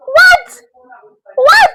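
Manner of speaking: high, loud disbelieving what; shocked what. Real, not acted. Reacting to being told she stinks, shocked cry. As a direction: shriek it in total disbelief. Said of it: "incredulous outburst".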